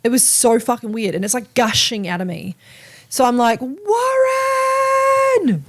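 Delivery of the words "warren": calling out. Retold or imitated. Calling out to partner.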